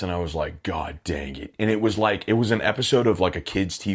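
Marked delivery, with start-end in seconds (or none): 0.6-1.5 low, softer grumble of defeat; muttered dismay. Retold or imitated. Kid handed push-ups after class, muttered dismay. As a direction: grumble it low under your breath.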